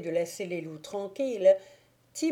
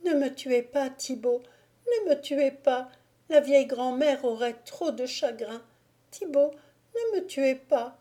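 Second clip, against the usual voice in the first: put-on higher, pleading young woman's voice; begging for her life; 6 semitones higher than usual. Kneeling Agnolette begs Thibaut to spare her, higher, pleading. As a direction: beg him on your knees not to kill you.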